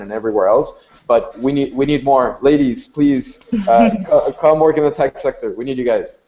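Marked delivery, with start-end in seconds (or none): none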